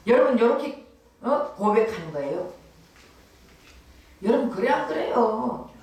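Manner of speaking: ordinary talk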